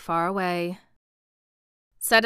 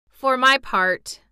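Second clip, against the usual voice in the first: higher, slow, careful opening; meek; 5 semitones higher, 7 dB louder, slower than usual. A narrator's reading. Private speaking up among officers, mildly meek. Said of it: "said the private meekly".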